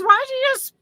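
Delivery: cousin's teasing voice. Retold or imitated. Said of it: "She goes".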